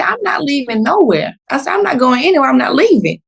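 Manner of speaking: high, insistent refusal; retold stubborn refusal; defiant. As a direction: dig in and repeat it, pitched high. Refusing to leave, rising.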